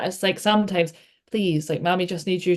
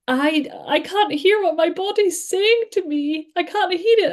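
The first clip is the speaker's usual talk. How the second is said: put-on higher, slow small-child voice; imitating her small daughter; 12 semitones higher than usual. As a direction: do her five-year-old's puzzled little voice. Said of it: "she says to me".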